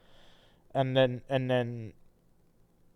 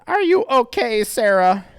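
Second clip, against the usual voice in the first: character voice; 11 semitones higher, 10 dB louder than usual. Acted. Reading Chris's line.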